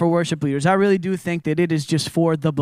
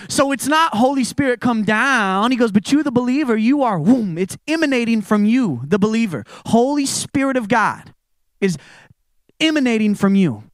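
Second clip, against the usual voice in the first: higher and louder, fired-up preaching quote; excited pastor; 7 semitones higher, 4 dB louder than usual. Retold or imitated. Friend passionately tells Chris the Spirit emanates, excited. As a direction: throw it out with building excitement. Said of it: "He gets really excited".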